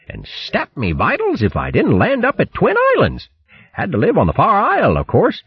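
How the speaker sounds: high, hearty hare voice striking a noble pose; swaggering plummy hare chap; boastful hare. Acted. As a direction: brag grandly about cheating the sea.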